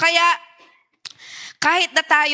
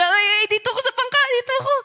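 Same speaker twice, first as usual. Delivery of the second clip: fast, high, rising panicky squeal; mock panicked Peter; 8 semitones higher, much faster than usual. Preacher mocking Peter swimming back to the boat in fast panic.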